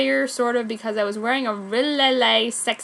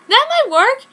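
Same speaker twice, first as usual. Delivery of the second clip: loud, high happy squeak; sudden hammed-up cheer; 10 dB louder than usual. Sakura agreeing with Haruko, a hammed-up happy squeal. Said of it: "I said happily".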